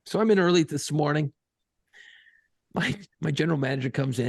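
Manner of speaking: usual speaking voice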